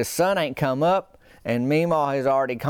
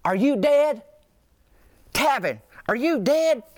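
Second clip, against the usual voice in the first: a worried grandma peering into the car, pitched way up; imitating Meemaw shrieking through the passenger window; Meemaw waking him; 12 semitones higher, slower than usual. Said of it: "I just hear".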